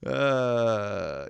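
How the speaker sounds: normal talking voice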